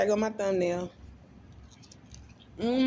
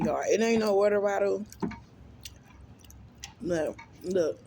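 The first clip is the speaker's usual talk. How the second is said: usual speaking voice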